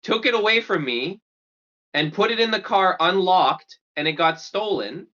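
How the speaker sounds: high, entitled whine; bratty argument. Retold or imitated. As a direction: argue like a spoiled kid. Kid arguing with parents.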